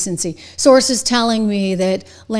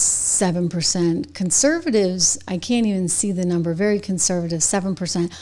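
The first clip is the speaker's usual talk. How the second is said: ordinary talk, nothing marked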